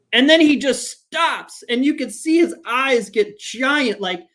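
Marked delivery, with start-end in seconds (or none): none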